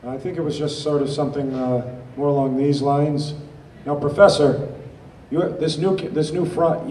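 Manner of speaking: nerdy accountant. Shown as a demonstration. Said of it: "nerdy accountant type".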